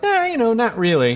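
high, airy dismissive reply; breezy denial. Retold or imitated. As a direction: brush it off lightly. Imitating nice people.

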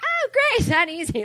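high sarcastic "Oh, great"; mock the guy with a high sarcastic squeal; high mocking sarcasm then a laugh.

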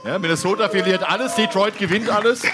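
high, loud and building; excited. Real, not acted. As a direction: rattle off the wild scenario. Excited hypothetical scenario.

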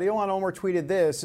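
ordinary talk

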